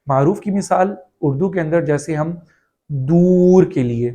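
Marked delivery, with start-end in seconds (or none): 2.9-3.6 دور said slowly with a full pure long u; maroof vowel demo. Shown as a demonstration. Teacher demonstrating دور with a pure long u. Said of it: "دور میں جو آواز نکل رہی ہے یہ معروف آواز ہے".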